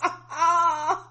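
high-pitched breathy laugh; high wheezing laugh. Real, not acted. Host cracking up at the couple, wheezing laugh.

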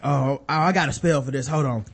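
lightly voiced low-key wizard; underplayed mock Gandalf, low. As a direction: play a casual wizard, voice dropped low. Acting Gandalf as casual, useless wizard.

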